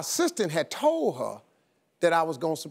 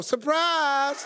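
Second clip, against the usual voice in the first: surprise reveal; 7 semitones higher than usual. Retold or imitated. Wife revealed at the door.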